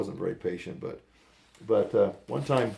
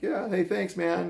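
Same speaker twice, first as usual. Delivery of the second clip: much higher, casual mock teen voice; imitating teen kids; 10 semitones higher, 3 dB louder, slower than usual. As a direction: toss it off like a cocky teenager, pitched way up. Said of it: "they're like".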